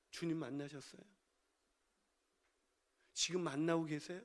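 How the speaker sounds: soft, low, searching question; hushed appeal. Real, not acted. Preacher asks congregation personally after shouting, hushed and low. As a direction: drop right down and ask gently.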